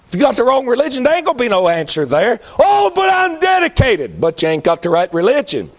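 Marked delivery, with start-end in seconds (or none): 2.6-4.2 high, slow whiny protest; mock pious objector. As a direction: mimic the self-righteous believer.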